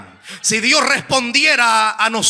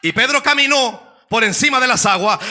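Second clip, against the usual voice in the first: full-force preaching; 5 semitones higher than usual. Acted. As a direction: push the crowd to answer amen.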